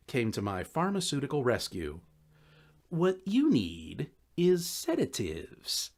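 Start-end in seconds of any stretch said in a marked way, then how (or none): 2.9-5.9 drug-dealer friend Dave. Acted. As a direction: slowly sell him on the pills.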